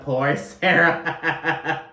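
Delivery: drawn-out words breaking into laughter; laughing pity. Real, not acted. Podcaster laughing over Sarah's awful embarrassment, pitying and amused. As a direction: laugh helplessly through it.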